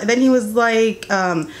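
usual speaking voice

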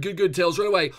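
ordinary delivery

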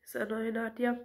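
usual speaking voice

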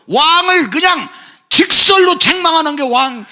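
high, loud pulpit shout; rising fiery sermon cry; preaching shout. Real, not acted. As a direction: thunder it at the congregation. Preacher thundering about prophet Nathan.

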